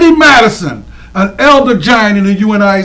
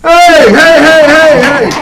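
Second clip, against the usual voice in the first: cheering hey; 6 semitones higher, 6 dB louder, slower than usual. Real, not acted. Cheering greeting to the crowd.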